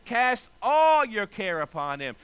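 slow verse with ALL punched loud; stress on ALL. Shown as a demonstration. Preacher corrects those who take burdens back, stress on ALL. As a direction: hit ALL hard and stretch it.

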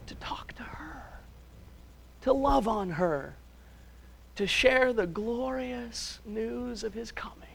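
soft, slow whisper; hushed whisper. Real, not acted. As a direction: draw them in with a hush. Tender climax of sermon.

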